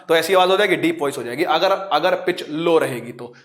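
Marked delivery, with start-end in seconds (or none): none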